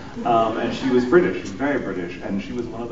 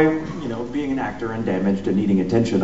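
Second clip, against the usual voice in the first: usual speaking voice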